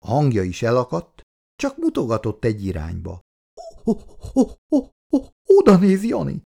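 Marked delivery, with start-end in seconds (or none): 3.8-6.4 high stuttered ho-ho, then a louder burst; frightened stutter, voice jumping up; stammering in shock. Acted. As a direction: stammer it out as if seeing a ghost.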